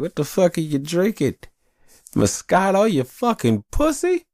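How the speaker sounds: high, scornful ribbing of a buddy; high mock-insult voice; mocking taunt. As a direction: tease him like a bully, pitch way up.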